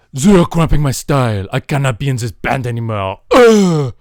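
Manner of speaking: loud mock diva huff; hammed-up diva quitting the band; diva tantrum. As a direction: storm off in a loud sulk. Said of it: "it's just like".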